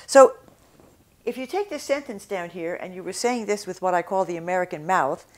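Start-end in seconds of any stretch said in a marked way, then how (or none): none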